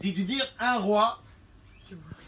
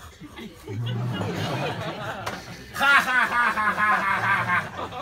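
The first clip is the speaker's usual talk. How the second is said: Spanish jota laugh. Acted. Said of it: "les Espagnols rient avec des J".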